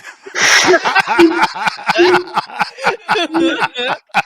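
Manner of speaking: long, high burst of laughter; helpless loud laughing fit. Real, not acted. Game master laughing at player's rudeness, loud and high.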